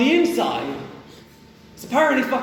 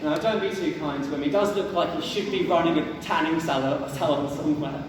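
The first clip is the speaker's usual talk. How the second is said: usual speaking voice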